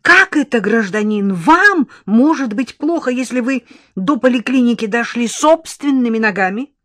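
loud, high mock astonishment; hoarse surprise. A narrator's reading. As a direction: challenge them in exaggerated surprise. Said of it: "хрипло удивилась блондинка".

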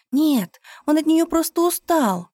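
high, loud protest; defensive. A narrator's reading. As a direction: jump to defend him. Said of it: "попыталась защитить любимого".

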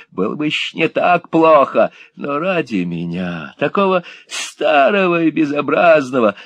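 high, loud mocking old devil; devilish glee. A narrator's reading. Gride mocking rejected suitor, devilish high glee. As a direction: gloat like a devil.

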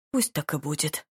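low-key, softened resignation; with a sigh. A narrator's reading. Duke yields to the lady, sighing softly. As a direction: say it on a soft sigh. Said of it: "со вздохом сказал Чарльз".

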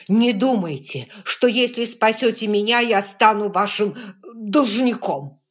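wheezing, loud and defiant; breathless shout. Acted. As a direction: shout through gasps for air. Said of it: "Выдох, вдох". (задыхаясь, громко и упрямо)

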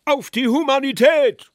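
high, loud heckler's yell; shouted toast. A narrator's reading. Guest toasts humanity from the table's far end, shouting. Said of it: "Wer hatte da eben geschrien?".

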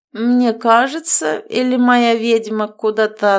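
slow, slurred tipsy voice; drunk slurring. A narrator's reading. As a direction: slur it like a drunk. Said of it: "заплетающимся языком спросил Корвус".

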